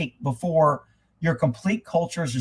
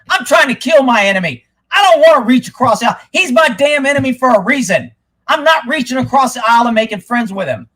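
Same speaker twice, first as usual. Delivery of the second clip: real heated outburst, raised pitch; fired up; 4 semitones higher, 4 dB louder than usual. Real, not acted. Refusing to befriend the political enemy, fired up. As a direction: let the anger drive it, loud and insistent. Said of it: "Shit, I got all fired up".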